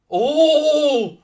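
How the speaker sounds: long, high, loud gasp of a cry; drawn-out rising-then-falling "oh"; alarmed "ooh". Real, not acted. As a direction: cry out at a near miss. Near miss at goal.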